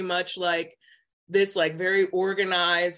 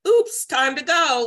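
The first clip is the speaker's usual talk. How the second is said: chirpy and drawn out, higher and louder; breezy mock exit; 8 semitones higher, 8 dB louder than usual. Telling her mom she's quitting, in a breezy mock chirp. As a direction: toss it off like a cheerful goodbye. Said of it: "I just was gonna be like".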